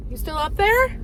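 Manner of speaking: high, concerned pet voice; worried coo to dog. Real, not acted. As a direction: check on the dog tenderly. Calling to the dog.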